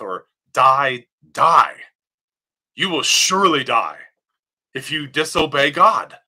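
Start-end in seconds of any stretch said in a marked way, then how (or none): 0.5-1.7 die, die hit loud, the second die louder; emphatic repetition. Shown as a demonstration. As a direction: hammer the doubled die for emphasis. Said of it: "it's there for emphasis".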